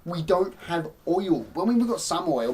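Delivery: usual speaking voice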